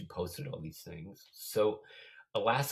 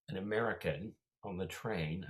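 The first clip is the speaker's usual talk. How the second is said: ordinary talk, nothing marked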